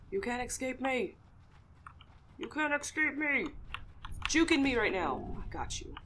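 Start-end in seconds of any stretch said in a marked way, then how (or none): 0.1-3.5 loud, higher mock threat; playfully threatening the rabbit while hunting; mock-menacing hunter. 4.3-5.2 exasperated. Real, not acted. Rabbit dodges her.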